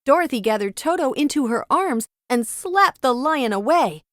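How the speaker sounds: plain reading voice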